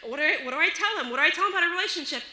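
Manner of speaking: fast, rising mock panic; panicked thinking. Parent unsure what to tell the boy, mock panic. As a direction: race through the worried questions, tumbling over each other. Panicked thoughts about boy's question.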